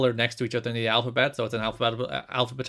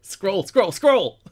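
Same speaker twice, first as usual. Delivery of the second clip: rising, louder panic with each scroll; frantic scrolling; 7 semitones higher, 6 dB louder, much slower than usual. Pretend. Playlist operator panicking at the board, building to a yell.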